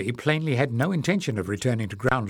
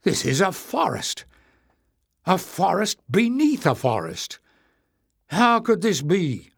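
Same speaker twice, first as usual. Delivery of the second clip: rising, higher and louder in wonder; amazed; 6 semitones higher, 3 dB louder than usual. A narrator's reading. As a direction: marvel at it. Amazed discovery of hidden forest.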